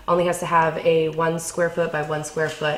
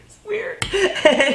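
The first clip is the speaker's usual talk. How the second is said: laughing; 12 semitones higher, slower than usual. Real, not acted. Laughing while calling farmer weird.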